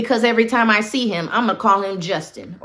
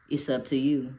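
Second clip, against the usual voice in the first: hushed aside; 3 semitones lower, 10 dB quieter than usual. Retold or imitated. Boss's silent look, voiced.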